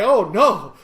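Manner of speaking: high, loud comic dismay; mock protest. Passenger bickering with his driver, laughing mock protest. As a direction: protest loudly, breaking into laughter. Passenger's joking retort to wife.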